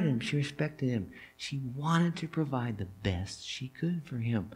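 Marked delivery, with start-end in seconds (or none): none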